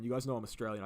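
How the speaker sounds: ordinary delivery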